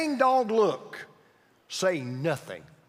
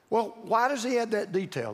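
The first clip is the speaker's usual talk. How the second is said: ordinary delivery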